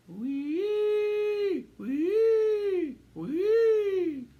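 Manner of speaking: loud high siren call; siren wee. A narrator's reading. Mouse driving the ambulance, high loud siren wail.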